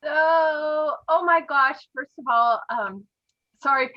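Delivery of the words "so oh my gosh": slow, choked-up, teary start; fighting back real tears as she begins; tearful. Real, not acted. Sponsor honoring a friend on a team call, tearful. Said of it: "I'm gonna start crying again".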